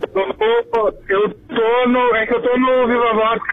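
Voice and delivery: ordinary talk, nothing marked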